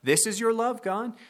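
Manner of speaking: high-pitched scoffing disbelief; sarcastic retort. Retold or imitated. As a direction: toss it back at God with scorn. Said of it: "Israel's sarcastic answer of".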